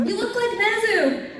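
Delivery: high, drawn-out fan protest; insistent fan. Retold or imitated. Audience member argues with the panelist, high and insistent.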